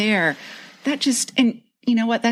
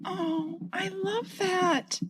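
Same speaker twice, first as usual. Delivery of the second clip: tender; 11 semitones higher, 10 dB quieter, much slower than usual. Real, not acted. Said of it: "That's longing".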